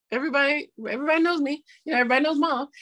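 lightly put-on little kid voice; imitating young daughter. Daughter boasting that mom is known, imitated child voice. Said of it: "she just kind of be like".

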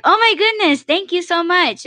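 high, bright gushing thank-you; delighted thanks. Retold or imitated. Grateful stranger at the store door, gushing high thanks.

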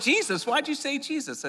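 high, startled echo of Jesus; surprised. Retold or imitated. Stranger at the bar, startled by the answer, asking back. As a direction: repeat Jesus back in disbelief.

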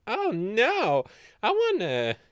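high, put-on wail; hammed-up moan; mock dismay.

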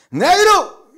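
put-on very high, loud drunken shout; almost shouting. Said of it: "Al final casi gritándole". (casi gritando, borracho)

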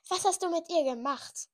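high, loud furious demand. A narrator's reading. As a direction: rage at him. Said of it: "fragte ich wut-, wutverzerrt".

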